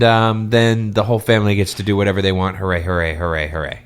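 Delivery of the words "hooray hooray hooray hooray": low, flat deadpan hooray; mock cheer. Real, not acted. Podcaster mocking the happy ending with deadpan hoorays. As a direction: cheer with no enthusiasm at all. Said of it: "mock celebration".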